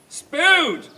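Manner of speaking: high, loud grand proclamation; declaimed. Acted. The Empress greeting Spode, declaiming loudly. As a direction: announce his name grandly. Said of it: "she declaimed".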